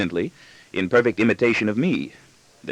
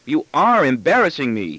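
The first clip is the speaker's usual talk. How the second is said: put-on higher child's voice, leaning hard on ARE; triumphant accusation; 6 semitones higher, 3 dB louder than usual. Son accusing his father of embarrassing him, triumphant. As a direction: accuse as if you have just won. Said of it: "he accuses triumphantly".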